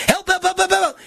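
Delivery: high, desperate "help" calls; mock cry for help. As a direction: act a patient begging from bed. Patient screaming in pain.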